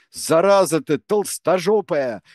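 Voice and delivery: furious woman shouting; yelling. A narrator's reading. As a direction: bawl him out for swearing. Selma keeps yelling at Tsvirik.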